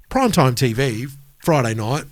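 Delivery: ordinary delivery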